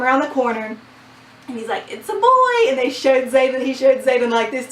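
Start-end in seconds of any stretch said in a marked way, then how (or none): 1.9-2.7 much higher, louder joyful announcement. Retold or imitated. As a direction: announce the boy with delight. Doctor announcing baby.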